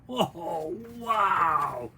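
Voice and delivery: plain reading voice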